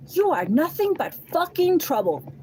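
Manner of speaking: high, loud, furious line; retold fury at the other woman; angry outburst. Angry final words.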